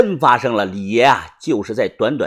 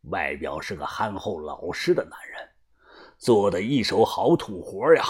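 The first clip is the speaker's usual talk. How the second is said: grave gang boss; 10 semitones lower, 9 dB quieter than usual. A narrator's reading. Boss clears the accused aunt, low and grave. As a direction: speak with quiet, deliberate authority.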